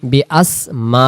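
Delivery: বিআসমা with the a after ম held long; stretched vowel. Shown as a demonstration. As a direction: draw out the a in বিআসমা. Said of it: "মিমের জবরটা আমরা টেনে ধরবো".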